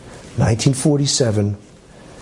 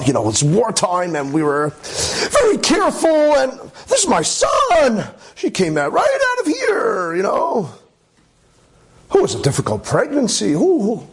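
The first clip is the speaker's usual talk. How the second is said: much higher, crying edge, flustered; flustered high mother; 9 semitones higher than usual. Retold or imitated. Mother lying to the priest, high and near tears. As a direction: stammer out a cover story near tears.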